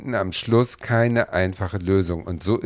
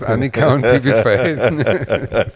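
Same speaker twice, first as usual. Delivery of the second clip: laughing; 4 semitones higher, 6 dB louder than usual. Real, not acted. Teasing question with laugh.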